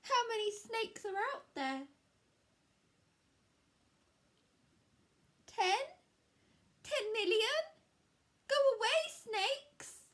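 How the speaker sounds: high, loud and frightened; panicked cry. Acted. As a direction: cry out, terrified of snakes. Scared Square counts snakes.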